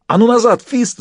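high, loud shouted order; yelling. A narrator's reading. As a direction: yell a warning to get back. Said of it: "заорал Джек".

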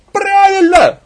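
very high, loud yell; woman's shouted support. Acted. Second woman backing the first, shrill shout. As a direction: shout your agreement over the crowd. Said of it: "поддержал другой женский голос".

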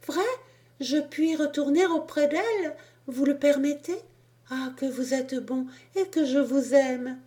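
put-on a little boy who can hardly believe his luck; joyful child. Cherub overjoyed at being allowed.

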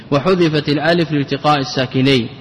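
normal talking voice

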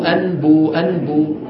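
ordinary delivery

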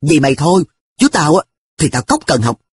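plain reading voice

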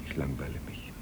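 whispered resigned confession; barely audible admission. Acted. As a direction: let the confession die away. Quiet admission of boredom.

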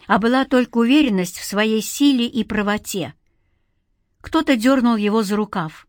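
ordinary delivery, nothing marked

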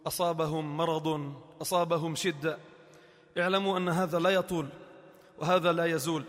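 tender quiet preaching; gentle consolation. Acted. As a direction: soothe the afflicted softly.

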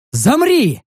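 sharp, high-pitched order; barked command. A narrator's reading. Narrator halts the orc, barked order. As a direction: snap it out like a commander. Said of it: "рявкнул я".

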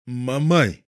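mumbled through closed lips; talking with mouth closed. A narrator's reading. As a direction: hum the words with mouth shut. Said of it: "промычал недомумия".

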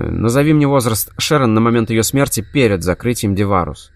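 ordinary delivery

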